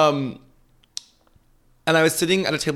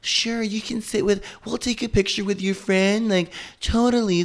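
high, sugary fake politeness; imitating her own fake-nice reply; mock sweet; 8 semitones higher than usual. To a rude stranger, a high fake-sweet brush-off.

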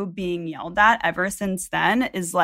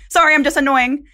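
louder, higher mock apology; joking apology; 7 semitones higher, 6 dB louder than usual. Pupil defusing an angry teacher with humor, joking apology. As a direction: blurt a cheeky sorry, pitched up.

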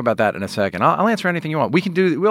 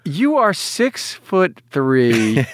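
slow drawn-out compliment; 3 semitones higher, much slower than usual. Real, not acted.

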